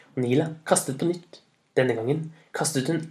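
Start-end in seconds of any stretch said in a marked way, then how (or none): none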